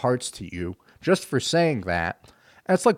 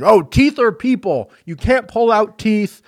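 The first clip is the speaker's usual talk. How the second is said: high, loud ranting protester; mock street shouting; 10 semitones higher, 8 dB louder than usual. Religious freaks shouting at a dentist patient, high and loud. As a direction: shout it like a street zealot at a passer-by. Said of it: "they're all shouting".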